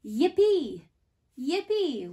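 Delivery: high, loud cheer of yippee; excited yippee. Shown as a demonstration. Preschool teacher showing excitement, loud happy yippee. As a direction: squeal yippee with delight. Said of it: "makes you so excited".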